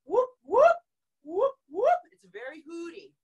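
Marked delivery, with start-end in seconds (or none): 0.0-2.0 high rising whoops like a siren; cop siren whoop. Shown as a demonstration. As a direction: sweep up like a police siren. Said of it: "Try that with me".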